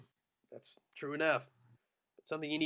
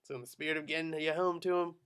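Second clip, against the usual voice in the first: usual speaking voice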